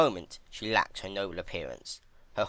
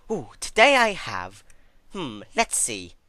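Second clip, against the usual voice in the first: much higher, louder gleeful coo, then slow musing; gleeful sadistic tease; 6 semitones higher, 10 dB louder than usual. Acted. Twilight presenting torture tools to Celestia, gleeful and much higher. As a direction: relish picking a torture tool.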